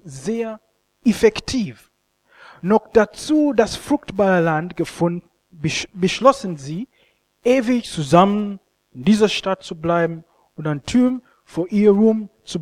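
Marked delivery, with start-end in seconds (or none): none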